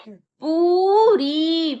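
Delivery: पूरी with the ऊ dragged out very slowly; long oo in पूरी. Shown as a demonstration. Teacher to class, stretching the long ऊ. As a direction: hold the पू for a long beat. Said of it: "देखो देर तक बोल रहा है ना".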